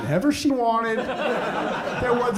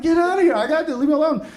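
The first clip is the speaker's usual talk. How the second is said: much higher, louder shout at the waitress; frantic mock yell; 9 semitones higher, 5 dB louder than usual. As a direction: snap her away in a panic.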